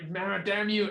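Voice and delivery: mock-upset outburst; upset cursing. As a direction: curse them in acted frustration. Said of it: "he is very upset".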